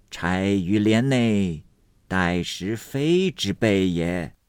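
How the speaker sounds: slow, chanting sage, words drawn long; cryptic old Taoist. Acted. Taoist hints at his identity to the official, cryptic. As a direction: intone it like an unhurried riddle.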